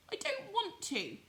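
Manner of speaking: high reluctant little rabbit. Acted. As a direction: refuse it in a high small voice. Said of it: "he said again".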